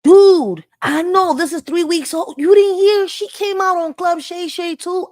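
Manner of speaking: sudden loud, high disbelief; excited outburst. Real, not acted. Streamer yelling to chat about Kamala's interview, excited shout. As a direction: blurt it out, shocked and hyped.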